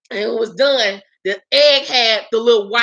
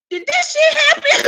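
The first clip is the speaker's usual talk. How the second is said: shocked disbelief; 12 semitones higher than usual. Retold or imitated. Waking up, panicked disbelief.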